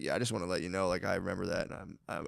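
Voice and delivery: soft, low sheepish apology. Retold or imitated. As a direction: mumble it apologetically. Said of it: "Be like".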